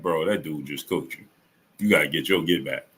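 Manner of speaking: low, soft and matter-of-fact; quietly level with him, no heat; calm. Retold or imitated. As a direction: imitate a friend's calm, frank verdict. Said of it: "he said it so calmly".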